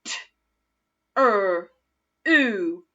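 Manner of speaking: T, er, oo said as separate sounds; sounding out. Shown as a demonstration. Teacher sounding out true together. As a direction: segment T, er, oo one at a time. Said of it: "Let's do that together".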